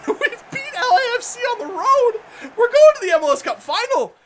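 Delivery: high, excited celebration; elated disbelief. Real, not acted. Gamer celebrating reaching the final, elated and high-pitched. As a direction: announce it with giddy joy.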